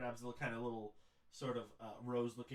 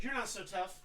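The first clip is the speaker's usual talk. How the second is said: taunting; 9 semitones higher, 7 dB louder than usual. Acted. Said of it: "she's like".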